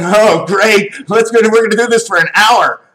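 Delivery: high, hurried mock enthusiasm in bed; eager husband. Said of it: "He's going".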